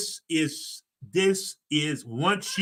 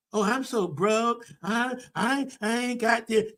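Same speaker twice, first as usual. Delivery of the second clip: higher, slow mock complaining; mock broke whine; 6 semitones higher, slower than usual. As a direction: whine it like someone always broke. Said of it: "Don't talk like that".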